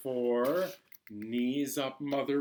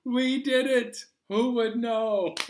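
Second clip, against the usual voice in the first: high, loud and delighted; excited cheer; 10 semitones higher, 9 dB louder than usual. Real, not acted. Teacher cheering the children after counting, excited. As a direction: cheer the class on for finishing.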